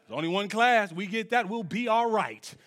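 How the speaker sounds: ordinary talk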